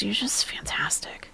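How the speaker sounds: soft whisper to the chat; whispered aside. Real, not acted. Streamer thanks the viewers in a whisper. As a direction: breathe it out as a fond secret.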